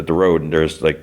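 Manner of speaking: ordinary delivery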